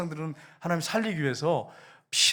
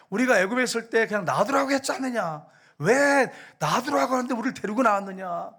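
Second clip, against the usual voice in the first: raised, higher complaining cry of the people; grumbling Israelites; 6 semitones higher than usual. Acted. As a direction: whine it at Moses as the crowd. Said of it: "모세를 막 원망하는데".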